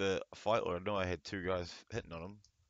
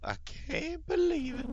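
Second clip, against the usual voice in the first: high slow falsetto; high mock voice. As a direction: squeak it out slowly. Reading character line.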